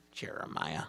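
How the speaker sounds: soft, low, whispered name; hushed disapproving aside; exasperated aside. Acted. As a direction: say it under your breath. Boss mutters about old employee.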